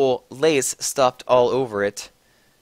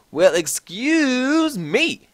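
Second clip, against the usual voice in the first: slow, much higher hammed-up indignation; mock angry shout; 11 semitones higher, much slower than usual. A narrator's reading. Snape and Lupin caught by Ebony, slow high mock outrage. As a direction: drag it out high and offended. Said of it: "they both shouted angrily".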